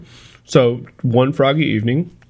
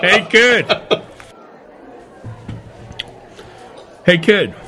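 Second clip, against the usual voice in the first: punchy, raised-voice hail; calling out; 7 semitones higher than usual. Acted. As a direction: call out to get a kid's attention. Calls out acted lines to a kid.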